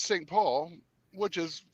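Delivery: usual speaking voice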